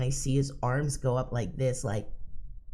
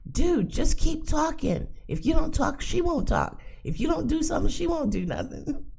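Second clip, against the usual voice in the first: imagined call out window. Pretend. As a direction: call out to the stuck driver. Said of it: "stick my head out and go".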